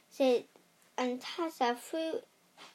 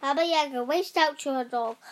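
plain reading voice

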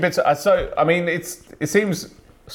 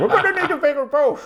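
put-on Irish accent; faster than usual. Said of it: "speak with a cigarette in your mouth".

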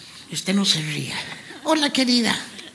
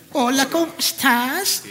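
usual speaking voice

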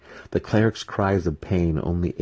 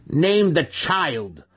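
slow, much higher, louder command; 12 semitones higher, 4 dB louder, much slower than usual. A narrator's reading. Brisa demanding her mother name the baby, slow shrill command. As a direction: order it, dragging each word. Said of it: "Brisa commanded".

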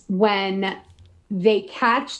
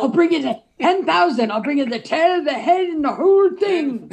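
mock Quint boast; 6 semitones higher, 4 dB louder than usual. Acting out Quint's bounty offer.

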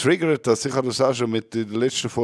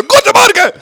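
very high, loud shouted greeting; shouting; 11 dB louder than usual. Pretend. Host shows friends life with only shouting, shouted greeting. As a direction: yell it at the top of your voice. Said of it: "Du kannst nur noch schreien".

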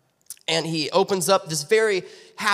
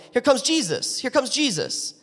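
raised, higher calls of the crowd; mock yelling; 7 semitones higher than usual. Blind man recalls a crowd yelling, higher mock call. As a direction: call out the news like someone in the street. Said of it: "I heard somebody yelling".

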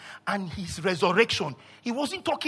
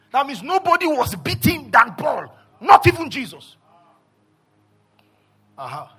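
usual speaking voice

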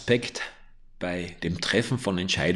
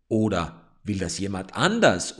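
usual speaking voice

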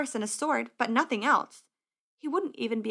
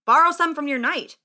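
loud, higher official voice; brusque scribe; 5 semitones higher, 8 dB louder than usual. Acted. Scribe sending squire to borrow armor, brusque. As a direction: give a loud, impatient instruction.

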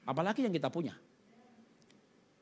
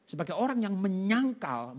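normal talking voice

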